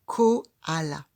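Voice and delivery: koala said loud and distinct, as a model word. Shown as a demonstration. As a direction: stand out koala for the child. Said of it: "la première syllabe de".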